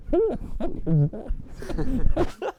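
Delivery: hammed-up crowd asking who dared; baffled outcry. Extras on set react to Ford, loud slow disbelieving mock yell. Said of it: "every single person on that set going".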